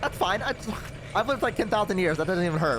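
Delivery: lightly tearful mock bravery; trying not to cry, acted. Elf downplaying her fall, mock-brave and near tears. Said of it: "trying not to cry".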